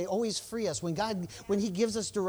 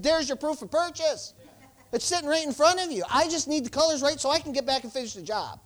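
raised, louder exasperated comeback; angry retort; 9 semitones higher, 6 dB louder than usual. Retold or imitated. Telling the paint clerk off, retold with real heat. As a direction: snap back at the store clerk with rising anger. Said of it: "Well, I got mad at him".

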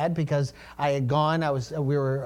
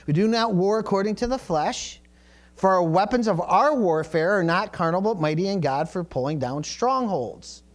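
scripture reading; 2 semitones higher than usual. Acted. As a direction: proclaim the verse to the congregation.

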